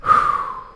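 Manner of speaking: tired exhale after running; breathless sigh. Real, not acted.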